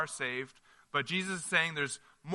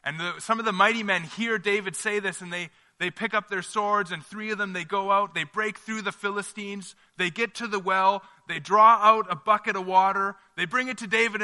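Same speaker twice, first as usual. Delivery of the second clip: raised, louder storytelling as the men charge; higher and louder as the story builds; building excitement; 4 semitones higher, 6 dB louder than usual. A narrator's reading. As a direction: tell it like an action scene, rising. Excited retelling of the mighty men.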